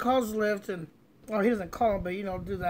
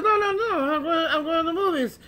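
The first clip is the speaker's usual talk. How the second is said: high, loud protest; imitating a friend's stubborn denial; insistent denial; 8 semitones higher, 5 dB louder than usual. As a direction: brush off the doubt in a raised voice. Friend insisting against the evidence.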